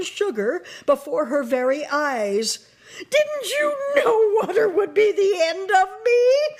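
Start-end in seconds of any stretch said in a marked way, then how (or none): 3.0-6.6 high, loud, crying witch wail; melting witch despairing to Dorothy, wailing. A narrator's reading. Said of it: "in a wailing, despairing voice".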